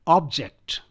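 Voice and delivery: "object" with the first syllable punched, OB-ject; stress on first syllable. Shown as a demonstration. Teacher drilling "object" as a noun, stress on OB. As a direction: hit OB in "object", let -ject fall away. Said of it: "I'll pronounce these four words as nouns".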